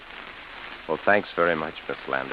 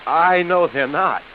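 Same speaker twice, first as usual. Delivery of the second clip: anguished outburst; 11 semitones higher, 7 dB louder, slower than usual. Acted. Distressed man insists he knows.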